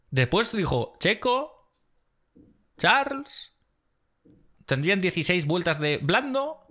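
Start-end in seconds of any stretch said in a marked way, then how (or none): 1.0-3.5 mock slow engineer. Imitating slow engineer radio.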